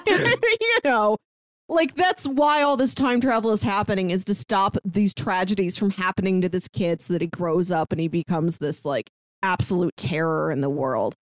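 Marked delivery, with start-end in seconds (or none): none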